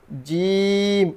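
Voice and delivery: জিম held out very long and even; জিম sung out slowly on a single held pitch; long drawn jim. Shown as a demonstration. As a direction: stretch জিম into one long steady tone. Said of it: "আবারও".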